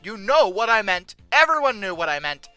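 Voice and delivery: ordinary talk, nothing marked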